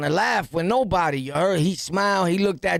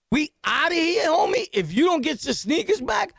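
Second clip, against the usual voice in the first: higher, fired-up warning; heated threat; 10 semitones higher than usual. Retold or imitated. Narrator warns robbers on friend's behalf, fired-up higher threat. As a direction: push it like a threat. Threat over stolen sneakers.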